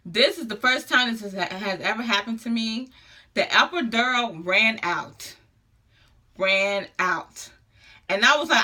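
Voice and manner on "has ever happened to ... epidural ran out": normal talking voice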